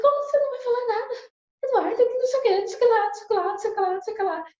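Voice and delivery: high, loud exasperated shouting at her husband, fading toward the end; angry outburst. Retold or imitated. Wife confronting husband who won't talk, angry shout. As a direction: blow up at him, demanding answers. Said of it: "Eu falei".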